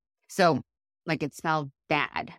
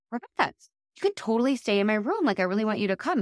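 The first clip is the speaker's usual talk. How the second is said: higher-pitched, eager retold invite; excited invitation; 6 semitones higher, 3 dB louder, faster than usual. Coaxing a friend to share her room, excited. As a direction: gush it as an eager offer. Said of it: "I was so excited".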